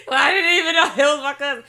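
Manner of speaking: loud, high laughing burst. Real, not acted. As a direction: crack up while telling it, loud and high. Laughing over her own story.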